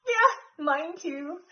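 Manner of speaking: usual speaking voice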